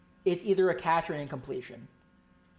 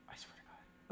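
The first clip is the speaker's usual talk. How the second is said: breathy whispered oath; whispered frustration; 26 dB quieter than usual. Real, not acted. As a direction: hiss it under the breath. Frustration at review.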